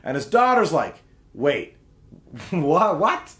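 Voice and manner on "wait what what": imitated bafflement, rising sharply on the last what; mock disbelief. Daughter hearing her dad's news, mock disbelief. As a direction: play up a confused double take.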